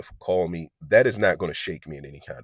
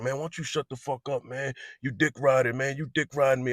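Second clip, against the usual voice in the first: put-on higher, jeering voice of the rival teen; mocking the other guy; 7 semitones higher than usual. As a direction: mimic the taunting kid, pitched up. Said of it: "he's like".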